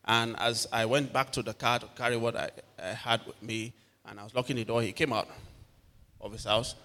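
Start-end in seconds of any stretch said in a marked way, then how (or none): none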